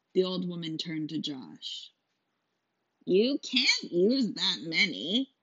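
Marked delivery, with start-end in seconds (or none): 3.1-5.3 slow, croaky crone warning; old witch voice; old hag. Acted. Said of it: "the hag said".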